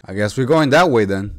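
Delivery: ordinary delivery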